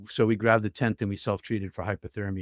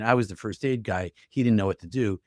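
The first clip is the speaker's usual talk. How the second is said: ordinary talk, nothing marked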